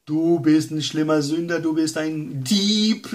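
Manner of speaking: lightly voiced accuser; accusing Satan. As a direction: condemn the thief like the devil. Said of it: "dann kommt der Satan und sagt".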